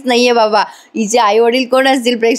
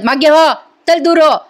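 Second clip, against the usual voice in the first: higher, louder shove-away shout; angry shout; 6 semitones higher, 3 dB louder than usual. Retold or imitated. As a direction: bark her away from you. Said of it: "एवढ्या जोरात ओरडतात". ("मागे हो" जोरात ओरडून दूर ढकलणे)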